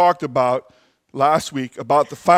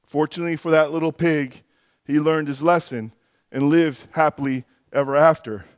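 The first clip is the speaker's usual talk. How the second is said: normal talking voice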